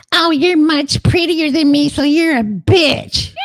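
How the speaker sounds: high falsetto mock voice; shrill mocking falsetto at the dog; mocking owner to dog. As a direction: squeal it up high like a catty rival. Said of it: "at their dog and go".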